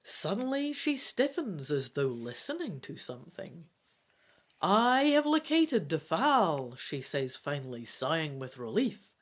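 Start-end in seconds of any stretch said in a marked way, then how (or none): 4.6-6.8 lightly voiced queen, a bit higher; a hint of a regal mother. Mother tells her son she found his sister.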